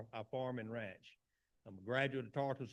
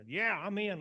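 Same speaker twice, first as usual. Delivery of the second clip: higher, louder burst of gung-ho support; eager agreement; 6 semitones higher, 6 dB louder than usual. Retold or imitated. Supporter answering the president's race call, eager agreement. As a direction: re-enact jumping on board eagerly.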